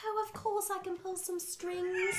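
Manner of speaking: bad British accent. Mocking the actress's British accent, high and posh. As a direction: do a terrible British accent, dearie. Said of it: "what her accent sounds like".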